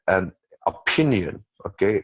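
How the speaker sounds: usual speaking voice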